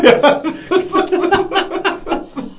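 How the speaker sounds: high rolling laughter; real laughter; long laugh. Real, not acted. Laughing at accent joke.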